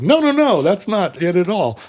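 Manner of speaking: high-pitched mock protest; Norman Rockwell. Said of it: "Norman Rockwell was like".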